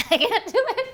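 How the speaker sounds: real burst of high-pitched laughter, words swallowed by it; cracking up mid-sentence; laughing. Real, not acted. Fails the tasting trick, cracks up.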